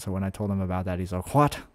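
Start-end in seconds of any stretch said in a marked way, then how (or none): 1.3-1.6 imitated Irish dad's "What?"; a short exclamation with an Irish lilt; Irish accent. Streamer retelling his dad's reply, Irish accent. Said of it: "my dad's got an Irish accent".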